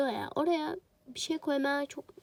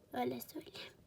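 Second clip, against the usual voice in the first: whispered aside; 6 dB quieter, faster than usual. Shown as a demonstration. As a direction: repeat it in a soft whisper.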